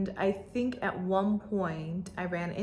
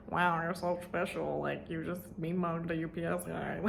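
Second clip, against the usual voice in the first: mock praise; 3 semitones lower, 6 dB quieter than usual. Teasing friend after the glare.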